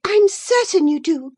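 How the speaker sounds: high, loud, angry comeback; high-pitched quarrelling girl; sharp retort. Acted. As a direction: fire it back in indignation. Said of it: "retorted Isabella".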